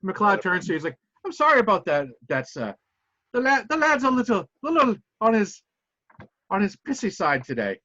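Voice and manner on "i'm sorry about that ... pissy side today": put-on Scottish accent. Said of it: "I'm gonna butcher the accent".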